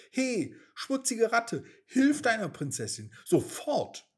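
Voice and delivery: angry and scared. Acted. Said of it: "rief Mimi verärgert und ängstlich zugleich".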